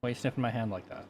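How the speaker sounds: usual speaking voice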